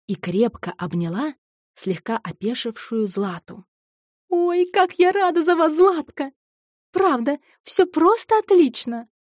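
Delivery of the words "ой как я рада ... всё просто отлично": high, loud delighted squeal; squealing with joy. Acted. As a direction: burst out with girlish delight. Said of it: "Иоанна тихо взвизгнула".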